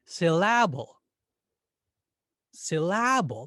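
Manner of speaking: "Syllable" as sil-LAB-ul, loud middle beat; second-syllable stress. Shown as a demonstration. Teacher testing a strange stress, exaggerated sil-LAB-ul. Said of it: "What if we stressed the second syllable".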